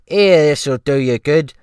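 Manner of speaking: loud, high servile cook voice; ship's cook. Acted. The cook asking the rescued man, in a loud high fawning voice. Cook hands him coffee.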